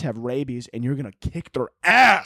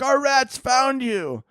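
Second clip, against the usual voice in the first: slow and much higher "Our rats found you", a hammed-up rescuer; mock rescuers; 10 semitones higher, much slower than usual. Rescuers finding you with dead rats, slow high mock voice. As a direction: drag it out in a high, silly rescuer voice. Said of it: "they're gonna be like".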